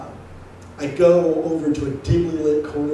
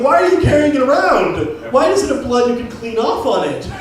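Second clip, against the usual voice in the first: higher and louder, accusing him through laughter; incredulous shouting; 7 semitones higher, 5 dB louder than usual. Retold or imitated. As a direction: call him out as a liar, loud and amused. Accusing the knife guy.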